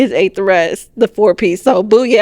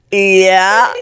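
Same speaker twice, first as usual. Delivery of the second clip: laughing; 2 semitones lower, 4 dB louder than usual. Retold or imitated. Awkward answer to cashier, laughing.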